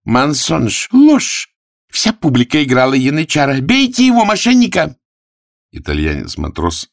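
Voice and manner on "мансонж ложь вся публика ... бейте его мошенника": high, loud outraged cries; angry denunciation. Acted. Prostitute incites the crowd against Arthur, high angry shouting.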